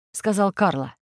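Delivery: plain reading voice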